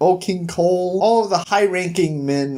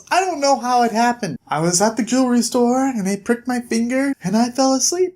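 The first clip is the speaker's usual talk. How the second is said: high, apologetic princess voice, lifted well above his own; a fairy-tale princess confessing a mishap, pitched high; Sleeping Beauty voice; 8 semitones higher than usual. Acted. Sleeping Beauty explains the mishap to Bigby, high and sheepish. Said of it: "And she says".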